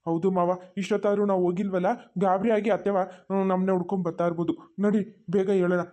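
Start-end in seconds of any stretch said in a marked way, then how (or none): none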